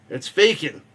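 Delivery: ordinary talk, nothing marked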